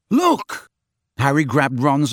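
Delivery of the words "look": urgent exclaim. Acted. Harry urging Ron.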